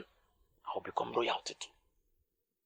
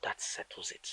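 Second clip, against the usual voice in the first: whisper; 8 dB quieter, slower than usual. Acted. Conspiratorial whisper to prince.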